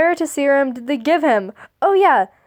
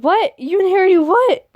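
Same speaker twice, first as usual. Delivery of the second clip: shocked; 6 semitones higher, 4 dB louder than usual. Acted.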